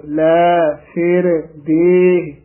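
very slow ਲੈ ਫਿਰ ਦੇਹ, each word separate; don't run ਲੈ ਫਿਰ together; words kept apart. Shown as a demonstration. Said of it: "ਇਕੱਠਾ ਨਹੀਂ ਕਹਿ ਦੇਣਾ".

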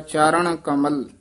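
ਚਰਨ said as charaṇ with retroflex ṇ; nasal ਣ in charan. Shown as a demonstration. Teacher correcting ਚਰਨ to a retroflex ṇ. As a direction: land ਚਰਨ on a retroflex ṇ, not n. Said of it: "ਇੱਥੇ ਨਾਣੇ ਨਾਲ ਹੈ".

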